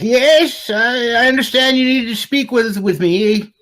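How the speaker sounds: put-on high, thin old wizard voice; an ancient gnome greeting visitors, pitched high. Old gnome wizard greeting the investigators, in a high voice. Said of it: "he's like".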